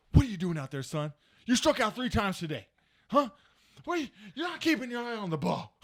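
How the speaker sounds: mock-stern, demanding rebuke; scolding dad. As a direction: imitate a father grilling his son. Dad scolding son by phone.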